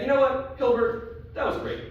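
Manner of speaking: lightly voiced rival mathematicians, pitched high; cocky one-upping. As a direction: imitate show-offs breezily brushing off a predecessor. Mathematicians acted out one-upping Hilbert.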